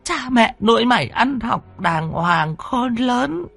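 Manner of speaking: anguished scolding on stretched syllables; tearful lament. Acted. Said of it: "Bà rơm rớm nước mắt".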